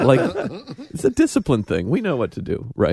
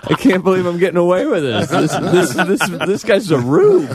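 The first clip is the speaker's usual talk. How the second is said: gloating criminal; 3 semitones higher, 3 dB louder than usual. Retold or imitated. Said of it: "You know, like".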